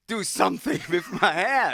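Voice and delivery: loud, high retold demand; hammed-up bossy imitation; Alan Carr. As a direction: call it out pitched right up. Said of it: "and then Alan went".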